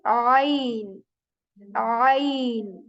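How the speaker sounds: ain with a throaty choked start; ain said slowly. Shown as a demonstration. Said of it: "name of this letter".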